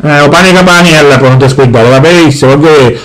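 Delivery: low, heavy father voice; gruff Sicilian dad. Acted. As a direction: boom it out like a grumpy papà. Father again.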